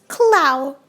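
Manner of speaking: "Clow" said with no final s; correct pronunciation of Clow. Shown as a demonstration. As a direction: say "Clow" low, ending open on the ow. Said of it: "not Klaus".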